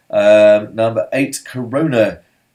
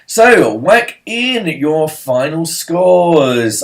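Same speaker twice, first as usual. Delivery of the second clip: loud, high, drawn-out host call; announcer call; 7 semitones higher, 8 dB louder, much slower than usual. Pretend. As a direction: stretch the words out to rally the quiz players. Quiz host calls for scores.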